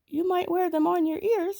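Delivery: put-on high little boy begging; small boy pleading. A narrator's reading. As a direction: squeak out the plea to the tiger. Sambo suggests to tiger.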